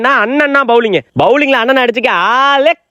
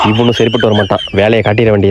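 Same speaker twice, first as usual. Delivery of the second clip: much lower grumbled aside. Acted. Father failing to amuse baby, resigned low aside. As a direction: drop right down, giving up.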